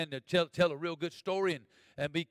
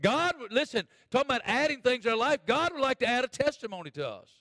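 raised, punchy preacher delivery; preaching; 8 semitones higher, 6 dB louder than usual. Real, not acted. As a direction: preach it out to the congregation.

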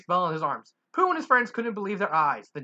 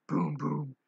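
ordinary delivery, nothing marked

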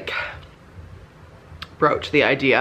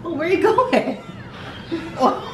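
high pet coo; 14 semitones higher than usual. Real, not acted. Owner asking her dog where he goes, high coo.

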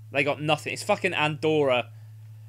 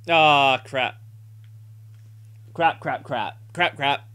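usual speaking voice

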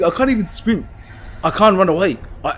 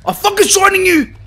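high loud battle cry; excited high shout; triumphant yell; 14 semitones higher, 5 dB louder than usual. Real, not acted. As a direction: shout as you blast it with light. Attacking monster with torch.

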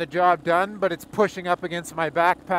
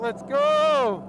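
excited cheer; 11 semitones higher, much slower than usual. Real, not acted. Cheering on reaching goal.

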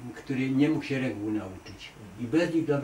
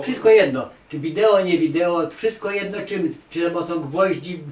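louder and higher, waving the question away; loud, dismissive; 4 semitones higher, 7 dB louder than usual. Real, not acted. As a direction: brush it off, all the same to me. Dismissing the interviewer's video question. (głośno, lekceważąco: „wszystko jedno”)

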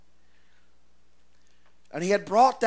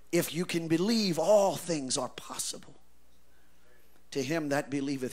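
soft, lower, earnest; quiet restatement; 3 semitones lower, 6 dB quieter than usual. Shown as a demonstration. Preacher repeats the verse softly to the congregation. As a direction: say it gently as the answer. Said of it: "So in other words".

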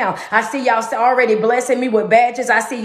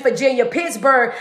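ordinary delivery

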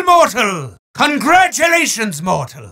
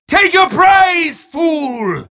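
shouted taunt; 6 semitones higher, much slower than usual. Acted. Demon yelling at the mortal winner, hammed-up shout. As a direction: bellow the taunt up high.

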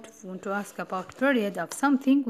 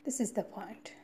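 whispering; 8 dB quieter than usual. Shown as a demonstration. Teacher whispers the key point.

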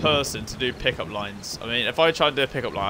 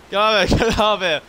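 loud, higher urgent call; urgent shout; 7 semitones higher, 6 dB louder than usual. Real, not acted. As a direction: hurry them out, loudly. Urging escape in game.

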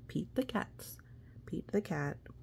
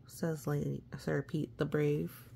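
ordinary delivery, nothing marked

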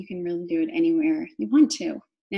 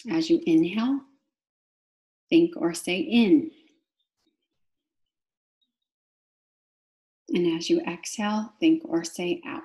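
guided meditation. Real, not acted. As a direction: draw out each cue word, pausing for the breath. Guides breathing with key words.

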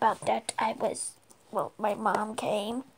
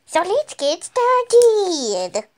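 slow, louder, stretched start; drawn-out louder kickoff; drawn-out intro; 5 semitones higher, 8 dB louder, much slower than usual. Acted. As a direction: announce it slowly to the viewers. Grand video intro.

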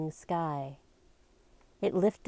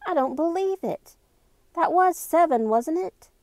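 high, raised voice, aghast; alarmed disbelief; 12 semitones higher, 6 dB louder than usual. A narrator's reading. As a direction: exclaim it in shocked disbelief. Shocked by the clock chiming seven.

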